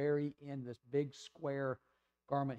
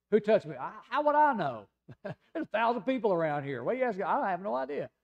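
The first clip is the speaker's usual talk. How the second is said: higher and louder, flustered and defensive; exasperated Peter; 6 semitones higher, 6 dB louder than usual. Retold or imitated. Peter protests to Jesus amid the crowd, exasperated and higher. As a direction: throw up your hands like a baffled disciple. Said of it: "Isn't that just like Peter?".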